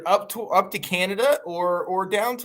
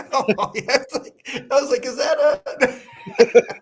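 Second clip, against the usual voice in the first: real laughter breaking the words, very slow; words stretched out by laughing; laughing through words; 10 semitones higher, 5 dB louder, slower than usual. Real, not acted. Laughing at the bad accent.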